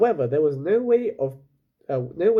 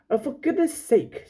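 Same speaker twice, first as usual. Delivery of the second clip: much higher, fed up; exasperated girl; 11 semitones higher than usual. Acted. Lila turns on the squabbling rowers, exasperated. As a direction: huff it out impatiently.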